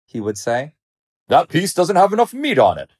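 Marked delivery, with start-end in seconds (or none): 1.3-2.9 high, indignant complaint; complaining lion. A narrator's reading. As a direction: complain pickily with raised pitch. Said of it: "he would complain".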